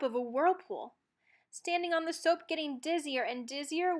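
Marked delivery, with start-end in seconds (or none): none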